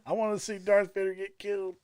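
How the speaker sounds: high-pitched hammed-up protest; mock childish outrage. Moviegoer who wanted Darth Vader killed, mock indignant whine.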